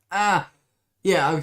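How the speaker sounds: sudden startled yelp, then rushed; alarmed cry breaking into haste. Acted. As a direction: jump at the noise and hurry off. Startled by ticking noise.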